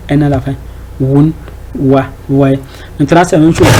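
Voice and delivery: ordinary delivery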